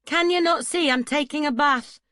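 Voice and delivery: high, loud mocking lilt; mocking his lilt. A narrator's reading. Bather mocking Duncan's accent from the tub, loud high taunt. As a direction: mimic his lilting accent to taunt him. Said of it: "She mocked the lilt of his accent".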